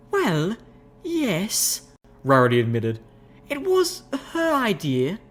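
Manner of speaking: high, refined lady's voice; refined unicorn. Acted. Rarity admitting the prank, high refined lady's voice. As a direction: speak like a prim high lady.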